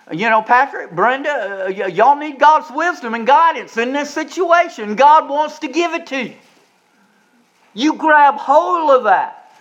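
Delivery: rising revival preaching; full-force preaching. Acted. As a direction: preach it hard to the congregation. Preaching climax, calling on members.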